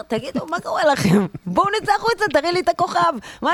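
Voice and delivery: high, impatient mock complaint to the guide; exasperated mock. Annoyed visitor mocking the slide lecture to the guide. Mocking the boring star lesson.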